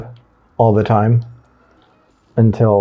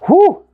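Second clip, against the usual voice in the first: short, loud, high "whoo"; high whoop; 5 dB louder than usual. Real, not acted. Player finishing the last placement, whooping. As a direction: let out a sudden whoop. Exclaims while placing items.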